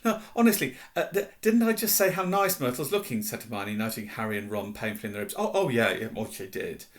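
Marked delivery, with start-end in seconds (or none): 0.0-3.2 high, loud, flustered protest; frantic insistence. A narrator's reading. Insisting to cover up a lie. 5.4-6.9 loud start, dropping off; hasty agreement. A narrator's reading. Nudged friends hastily agree.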